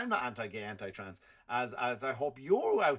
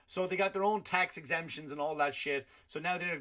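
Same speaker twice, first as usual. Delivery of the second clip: normal talking voice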